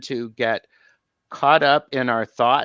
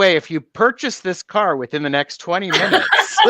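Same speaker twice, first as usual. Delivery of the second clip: car ad voice; 6 semitones higher, 6 dB louder than usual. Pretend. Mock car ad pitch.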